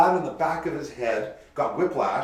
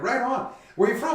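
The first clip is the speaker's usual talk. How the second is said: friendly bar patron; 13 semitones higher, 4 dB louder than usual. Retold or imitated. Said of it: "And they're like".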